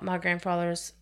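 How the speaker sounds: plain reading voice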